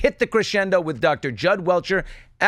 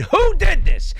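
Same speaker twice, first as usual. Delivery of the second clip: higher and louder, demanding an answer; outraged demand; 13 semitones higher, 6 dB louder, slower than usual. Real, not acted. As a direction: fling the question out in outrage.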